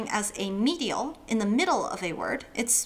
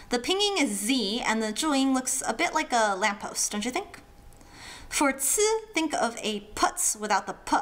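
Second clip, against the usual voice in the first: usual speaking voice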